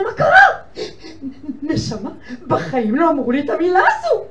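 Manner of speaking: high, weepy laughing-crying voice; tearful caller. Retold or imitated. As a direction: speak through tears and a laugh. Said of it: "פורצת בבכיות".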